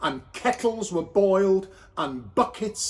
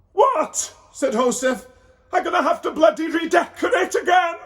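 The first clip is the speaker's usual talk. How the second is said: much higher, loud fast rant; shocked outburst; 11 semitones higher, 6 dB louder than usual. A narrator's reading. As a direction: explode in dismay. Joseph shocked by baby girl.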